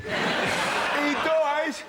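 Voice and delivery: high-pitched mock drunken yell; drunk passer-by. Drunk passer-by repeating the spoiler to the queue, high mock yell. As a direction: imitate a drunk shouting the spoiler, high and drawn out. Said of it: "someone who's drunk would just wander past".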